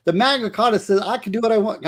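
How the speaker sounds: a squeaky put-on Yorkshire accent. Co-host showing how to read the super chat in Yorkshire accent. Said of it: "You gotta read it in her accent".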